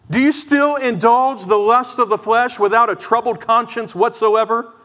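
high, loud, urgent pulpit preaching; loud, high-pitched fiery exhortation; preaching at full force. Acted. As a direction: thunder the warning at the congregation. Pastor exhorting the congregation.

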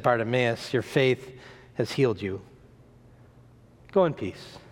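low, soft, unhurried blessing; hushed, low-pitched pronouncement; gentle reassurance. Acted. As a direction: speak quietly and kindly as a healer. Jesus gently heals the beggar.

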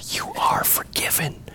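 slow soft whisper of forgiveness; tender whispered blessing; hushed absolution. Real, not acted. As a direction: breathe the words you are forgiven gently. Pastor pronounces forgiveness over congregation.

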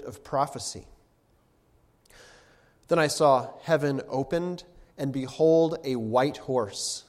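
solemn, measured Bible reading; scripture reading. Acted. Preacher reads Revelation to the congregation, solemn reading. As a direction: read the passage out to the church, building at the quotes.

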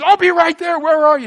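high, loud, eager "I'll be right there"; eager man answering her cry for help, excited; eager hero reply. Retold or imitated. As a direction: jump to the rescue.

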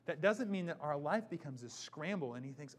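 usual speaking voice

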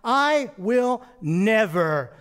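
loud, slow, high-pitched emphatic refusal; dead-set doubter laying down his terms; emphatic defiant vow. Acted. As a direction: declare it forcefully, dragging out each key word. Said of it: "I don't know that he said it like that".

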